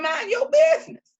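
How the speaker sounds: high, loud mock snap at the husband; sassy retort. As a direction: put him in his place, playfully. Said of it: "and I tell him".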